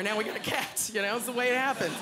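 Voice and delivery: ordinary talk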